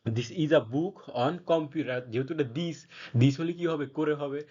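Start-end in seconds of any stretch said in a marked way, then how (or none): 0.1-2.0 slow reading aloud. Shown as a demonstration. Teacher reading the example sentence to students, very slow and deliberate. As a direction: read the English sentence out very slowly, word by word. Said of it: "তাহলে".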